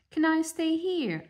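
high small hopeful bird; ugly duckling. Acted. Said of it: "asked the ugly duckling".

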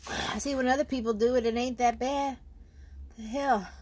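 high, loud strained talk; strained pained voice. Real, not acted. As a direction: talk through the burning. Still burning from wasabi.

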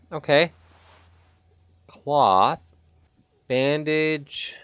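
ordinary talk

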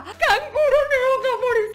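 high, slow, half laughing half crying. Real, not acted. Vlogger seeing a neon kangaroo offered, overjoyed. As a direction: gasp at the prize through laughter.

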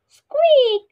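very high, loud squeak; squeaky mouse voice. Shown as a demonstration. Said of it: "sound that mouse make is".

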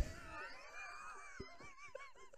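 high, breathy squealing laugh; shrieking laugh. Real, not acted. Vlogger laughs hard at his own fangirl impression.

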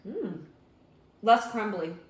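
chewing while talking; talking between bites; mouth full. Real, not acted. Seen 0: Unsure who speaks; both hold food at mouth, chewing throughout.

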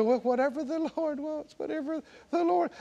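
falsely humble. Retold or imitated. Preacher mimics believers who think they're being humble, meekly resigned. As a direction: imitate pious, helpless resignation. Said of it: "think you're being humble".

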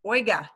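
Oiga said as OY-gah, a short falling call; spoken command. Shown as a demonstration. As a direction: Oiga with a clear final a, falling. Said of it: "the command you're gonna hear actually will sound like this".

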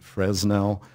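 Fresnel with the S sounded, FREZ-nel; "Fresnel" as frez-NELL, S voiced; common mispronunciation. Shown as a demonstration. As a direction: pronounce the S in Fresnel as a Z. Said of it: "We've heard Fresnel".

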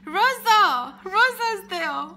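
loud, excited call of a name. Real, not acted. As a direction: shout the name out with delight. Spotting a friend in the chat.

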